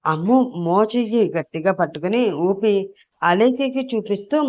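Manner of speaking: plain reading voice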